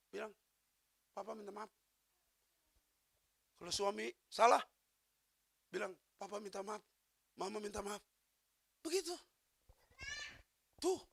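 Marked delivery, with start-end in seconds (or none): 1.1-1.7 soft, sincere little apology; gentle apology. Shown as a demonstration. Spouse apologising to the other, soft and sincere. 6.1-8.0 soft, sincere little apology; gentle apology. Shown as a demonstration. Spouse apologising to the other, soft and sincere.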